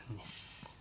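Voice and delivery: whisper. Real, not acted. Whispered demo aside.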